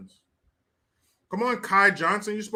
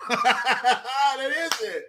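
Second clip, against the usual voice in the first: ordinary talk, nothing marked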